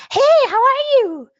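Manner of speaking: very high, loud, drawn-out hello; high-pitched greeting. Shown as a demonstration. Teacher demonstrating tone to class, high-pitched and excited. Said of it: "Sounds very high pitched".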